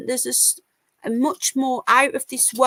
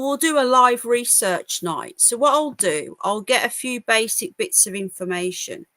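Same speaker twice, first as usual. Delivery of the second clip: ordinary delivery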